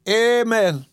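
high, drawn-out pious amen; Mister Buford. Acted. Visitor echoing the caretaker's submission, pious. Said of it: "Mister Buford echoed with an".

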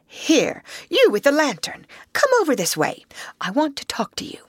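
high, brisk orders; masterful. A narrator's reading. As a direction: summon him over with authority. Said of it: "said the masterful Rat".

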